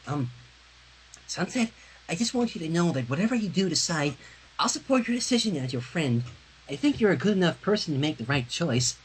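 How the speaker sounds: gentle, timid reassurance to a friend; shy friend's voice. Acted. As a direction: speak it shyly, as if afraid to intrude.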